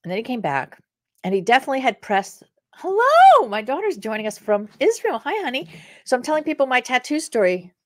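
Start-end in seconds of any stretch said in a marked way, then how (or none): 2.7-5.7 happy greeting. Real, not acted. Greeting daughter on live.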